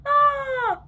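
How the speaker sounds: very high, loud shocked scream; yelled ah. A narrator's reading. Moms scream at their sons' announcement, loud and high. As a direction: shriek in horror. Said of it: "Both moms yelled".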